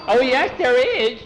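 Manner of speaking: high, loud, drawn-out contradiction; urgent protest. Acted. Skeeter contradicts being told there is no hurry, urgent protest. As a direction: insist slowly in a high, loud voice.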